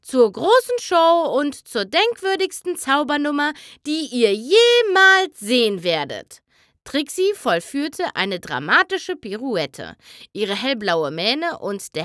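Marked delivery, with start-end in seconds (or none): 0.0-6.4 booming ringmaster welcome; grand showman. Acted. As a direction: announce it like a vain stage magician. Showmare greets the crowd. (großspurige Showansagerin)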